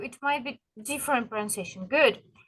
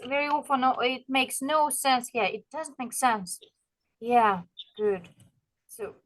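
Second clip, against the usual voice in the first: ordinary delivery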